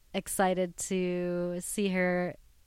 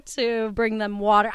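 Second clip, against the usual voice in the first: normal talking voice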